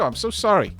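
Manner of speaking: ordinary talk, nothing marked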